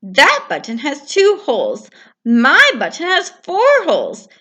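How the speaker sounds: irritated Toad. Acted. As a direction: push it away crossly. Toad, cross.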